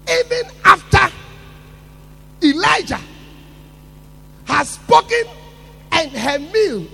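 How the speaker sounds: loud, high preaching cry; preaching shout. Acted. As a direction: thunder it from the pulpit. Preaching at full force.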